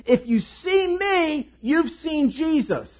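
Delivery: high, slow and bold; bold declaration. Shown as a demonstration. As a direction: proclaim it slowly and boldly. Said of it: "the courage and the boldness to say this".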